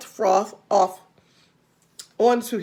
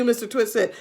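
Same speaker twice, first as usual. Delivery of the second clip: ordinary delivery, nothing marked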